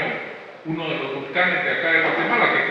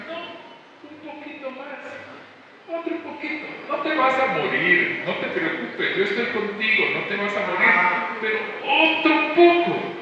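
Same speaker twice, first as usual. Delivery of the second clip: God's gentle voice; 5 semitones higher than usual. Acted. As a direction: speak like a loving father who comforts. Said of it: "como que él dijera". (voz suave y tierna de Dios)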